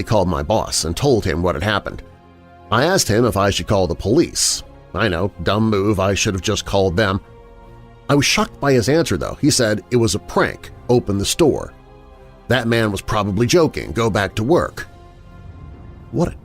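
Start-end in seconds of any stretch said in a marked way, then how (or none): none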